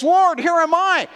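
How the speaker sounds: high, eager cry of readiness; eager volunteering. Acted. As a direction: step forward and offer yourself gladly. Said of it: "and he says".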